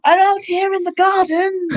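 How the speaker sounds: high, slow, stretched-out call; calling from far away. Acted. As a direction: act as if calling from down the garden. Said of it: "suddenly I'm really far away".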